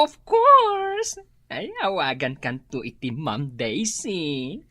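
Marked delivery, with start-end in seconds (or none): 0.0-1.1 high, loud delighted cry; excited agreement. Acted. Office gossip delighted by a promised treat, loud and high. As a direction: shout it with glee.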